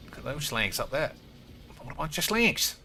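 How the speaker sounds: ordinary delivery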